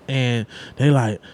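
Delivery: ordinary talk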